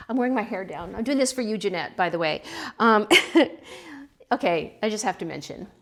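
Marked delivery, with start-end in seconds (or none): none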